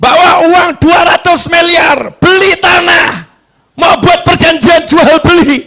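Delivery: high, declaiming sermon voice; full-force preaching. Acted. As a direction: call it out to the whole congregation.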